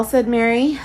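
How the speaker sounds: ordinary delivery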